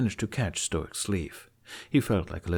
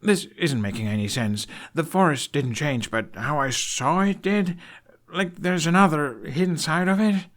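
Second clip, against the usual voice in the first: put-on higher, louder young man, growing insistent; frustrated Hiccup; 8 semitones higher, 4 dB louder than usual. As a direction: push harder to be understood.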